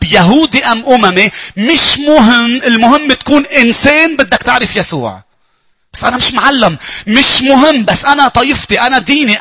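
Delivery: loud, high fervent sermon voice; preaching at full force. Acted. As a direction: proclaim it to the whole church. Preacher proclaims to congregation.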